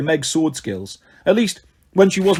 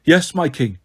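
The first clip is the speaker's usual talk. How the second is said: ordinary delivery, nothing marked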